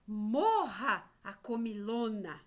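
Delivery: loud, slow, drawn-out exclamation; forceful story cry. Acted. Teacher quoting the insect who went too far, loud forceful cry. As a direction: proclaim it, stretching the first word. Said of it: "que forte isso".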